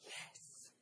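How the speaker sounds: breathy, unvoiced single word; whispered. Child whispering to the caller on the phone. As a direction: barely breathe the yes. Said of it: "back came the whispered response".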